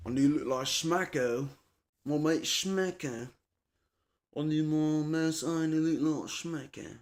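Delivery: a light put-on Aussie accent; hint of an Australian mate; mock Australian accent. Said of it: "that's British".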